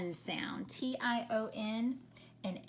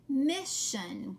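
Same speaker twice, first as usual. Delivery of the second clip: normal talking voice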